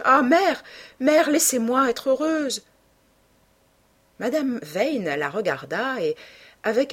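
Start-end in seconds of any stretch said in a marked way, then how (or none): 0.0-2.6 high, loud pleading cry; impassioned plea. Acted. Daughter begging her mother to let her be happy, impassioned. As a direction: burst in pleading, then draw the words out.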